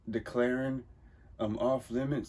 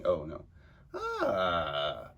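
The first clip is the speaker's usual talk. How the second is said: usual speaking voice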